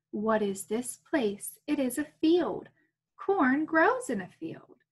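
put-on reading to children. Reading picture book aloud.

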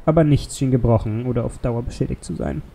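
ordinary delivery, nothing marked

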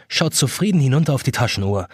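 ordinary delivery

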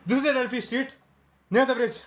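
high, loud, urgent cries for help; frantic shout for help over an injured woman; panicked shouting. Acted. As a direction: yell for an ambulance in panic. Calling for ambulance after accident.

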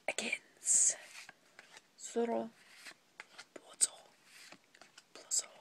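hushed, breathy whisper through the card list. Real, not acted. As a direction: whisper each card name as if afraid to jinx it. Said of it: "Why am I whispering?".

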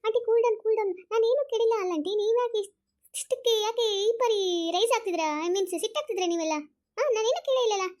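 high, loud flustered pleading; agitated protest. Acted. Younger one begging an angry aunt to cool down, loud agitated protest. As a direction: protest urgently over the anger.

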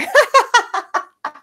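high, loud laugh; burst of laughter. Real, not acted. Laughing at mentor's message.

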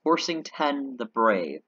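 slow, deliberate title; slow correction. Acted. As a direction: spell out the title slowly and grandly. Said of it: "Sir, not Sor".

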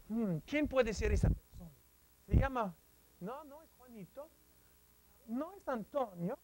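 soft, wondering guess to oneself; musing quietly. Shown as a demonstration. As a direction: murmur the guesses as if thinking aloud. Acts out guessing who it is.

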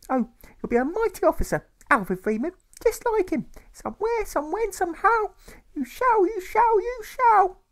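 very high, loud, sing-song mother insisting; a grieving mother clinging to hope; tearful mother. A narrator's reading. Mother reassures her son about his father, high and loud.

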